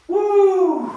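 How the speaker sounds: high loud woo; real whoop of excitement; excited whoop. Real, not acted. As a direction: cheer yourself on after the sprint. Cheer after fast count.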